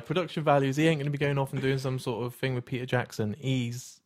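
ordinary delivery

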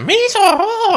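very high, drawn-out cartoon alien squeal; Jar Jar Binks. Co-host mocking the actor on the convention circuit, high cartoon impression. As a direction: squeak it out as a silly high-pitched creature. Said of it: "just Jar Jar Binks voiceovers".

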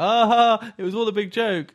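high, loud, hammed-up announcement; mock filmmaker claiming. Mocking the director turning up at cinemas, high loud showy voice. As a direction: imitate a showy "gotcha", pitched well up and loud. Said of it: "turns up, like".

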